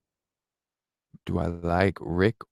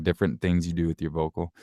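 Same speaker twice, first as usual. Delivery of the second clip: ordinary talk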